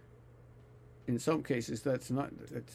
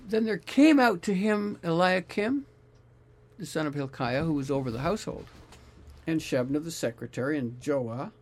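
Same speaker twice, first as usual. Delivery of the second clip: scripture reading; 3 semitones higher, 4 dB louder than usual. Acted. Reads scripture passage aloud.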